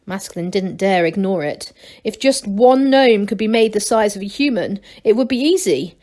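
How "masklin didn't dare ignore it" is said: usual speaking voice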